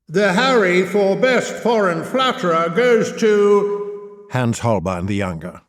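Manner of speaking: grand, slow, drawn-out awards host voice; milk the suspense like an Oscars presenter; awards announcer. Pretend. Host reading out the Best Poet award, in a grand slow announcer voice.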